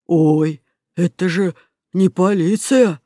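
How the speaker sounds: slow, drawn-out astonished crook; stretched-out amazement; surprised. Acted. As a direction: let the realization dawn slowly. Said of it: "удивлённо произнёс Бруно".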